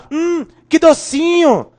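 high, slow, savoring hum; drawn-out mock delight at a sweet taste; savoring the sweetness. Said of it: "A gente fala".

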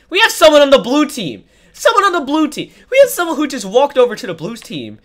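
loud, high urgent announcing; excited shout. Real, not acted. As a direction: call it out to everyone. Excited call-out about traitor.

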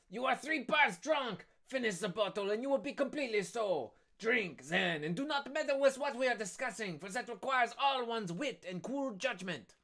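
high-pitched condescending character voice; scolding schemer. Acted. Acted Danglars scolding a drunk.

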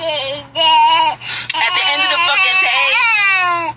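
slow, drawn out through tears; pushing words out while crying. Real, not acted. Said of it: "I'm crying because I'm so passionate".

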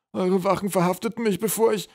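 high, plaintive character voice; anxious troll. A narrator's reading. Troll prisoner blames the guards for arresting him, anxious. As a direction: explain yourself in a high voice.

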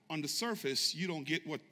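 usual speaking voice

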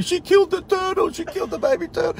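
put-on high, wailing child voice; mock kids screaming. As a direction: kids wailing over the turtle, high and shrill-pitched. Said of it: "The kids are screaming".

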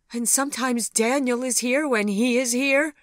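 high, loud alarmed accusation; accusatory, frightened high-pitched question; fearful and accusing. A narrator's reading. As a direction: ask it in fear, pointing blame. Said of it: "asked Sophie fearfully and accusingly".

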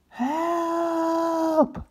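high desperate cry; cry for help. Acted. Chris crying out, stuck in the briars. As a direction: call out in panic. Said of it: "cried Chris".

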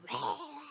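mock baby wail; high, hammed-up sob; imitated crying. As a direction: imitate a bawling newborn.

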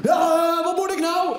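high, loud complaining dad; panicked father fumbling his vest; hammed-up helpless dad; whiny father. Father struggling with life vest.

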